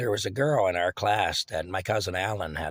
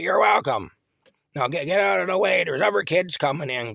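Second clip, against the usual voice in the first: put-on high and loud, shooing the kid away; impatient old lady; 8 semitones higher, 7 dB louder than usual. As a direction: hurry the kid off like a crabby granny. Aunt Sarah voiced in story.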